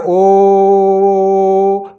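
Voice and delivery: ओ held very long and slow, like chanting Om; long drawn-out O. Shown as a demonstration. Teacher showing pluta to students: ओ held very long. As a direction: stretch the ओ far past a normal vowel. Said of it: "हमें देर तक करना है".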